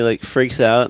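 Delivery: ordinary delivery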